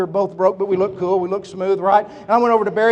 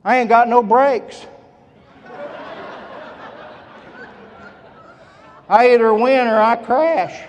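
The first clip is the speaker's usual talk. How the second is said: put-on country boy. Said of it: "he's just a country boy".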